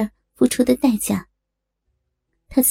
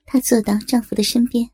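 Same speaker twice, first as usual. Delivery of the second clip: ordinary delivery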